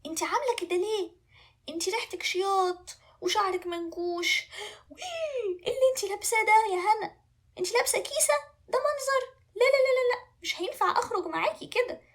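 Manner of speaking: high-pitched, fussy disgust; appalled prince. Acted. Prince rejects dishevelled princess, appalled and high-pitched.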